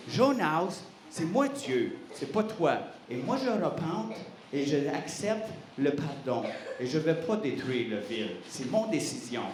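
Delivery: a hint of a deep divine voice; lightly voiced God. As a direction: settle him down like a patient father. God answers Jonas calmly.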